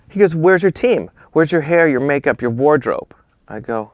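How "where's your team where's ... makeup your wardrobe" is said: higher, louder imitation with a thick accent; Gilles Bensimon's thick accent. Famous photographer grilling a beginner, in a thick accent. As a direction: demand it in a mock thick accent. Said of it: "with his thick accent".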